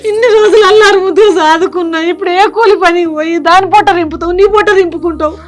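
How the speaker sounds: tearful scolding. Acted. Mother scolds her son through loud tears. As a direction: scold him through sobs, loud and hurt.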